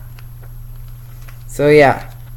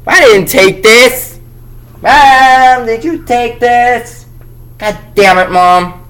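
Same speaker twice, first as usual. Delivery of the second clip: loud, higher outraged yell at mom; 8 semitones higher, 5 dB louder, slower than usual. Pretend. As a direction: call out to mom, fed up and accusing. Yelling at mom over comic.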